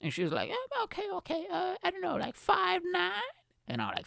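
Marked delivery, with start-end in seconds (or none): 0.5-3.3 put-on high unsure girl; hesitant girl. Girl guessing his height again, high hesitant voice. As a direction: guess timidly.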